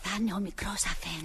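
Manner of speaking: low, very soft murmur to herself; hushed aside. Acted. Maid guesses it is the young master, hushed aside. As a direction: slip it in under your breath.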